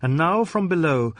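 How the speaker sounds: usual speaking voice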